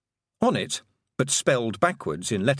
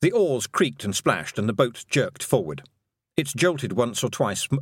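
plain reading voice

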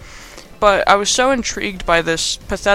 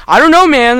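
high, loud outburst; mock panicked yell; 7 semitones higher, 9 dB louder than usual. Adam protests to the doctor about kidneys, mock panicked yell. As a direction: blurt it out in exaggerated panic. Acted argument over missing kidneys.

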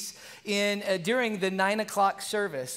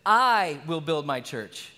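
I will build my church with I stressed; slow, with the weight on I; 4 semitones lower, slower than usual. Shown as a demonstration. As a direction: lean on the word I, slow and weighty. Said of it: "The first one is this".

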